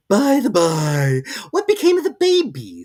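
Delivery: high, loud, slow teasing cat; grinning cat. A narrator's reading. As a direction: tease her sweetly. Cheshire Cat reappearing.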